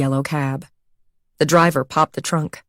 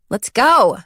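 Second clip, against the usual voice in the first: raised, impatient call; 6 semitones higher, 4 dB louder than usual. Acted. Anxious Kate rushing her friends in, impatient. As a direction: hurry them into the elevator.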